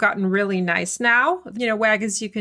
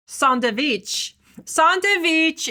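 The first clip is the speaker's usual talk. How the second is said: sandwich said as sahn-tuh-vich, Persian accent; playful Persian accent on sandwich; 6 semitones higher, much slower than usual. Shown as a demonstration. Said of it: "my silly way of saying it with a Persian accent".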